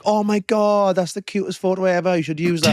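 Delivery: high-pitched gushing imitation; Millie. Girlfriend gushing over a goofy photo, mimicked adoration. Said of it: "Millie's like".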